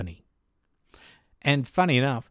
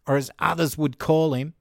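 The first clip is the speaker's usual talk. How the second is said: ordinary talk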